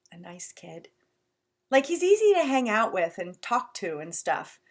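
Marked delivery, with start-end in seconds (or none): 0.1-0.9 unvoiced soft whisper. Real, not acted. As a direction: breathe it like a secret. Whispered aside.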